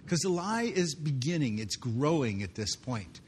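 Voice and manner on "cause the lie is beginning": normal talking voice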